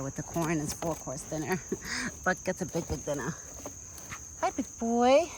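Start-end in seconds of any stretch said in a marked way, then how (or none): 4.4-5.3 higher, fond greeting for an animal; pet talk to deer. Real, not acted. Woman greets the deer she feeds, fond pet voice.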